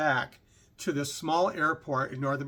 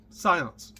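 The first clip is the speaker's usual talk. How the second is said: usual speaking voice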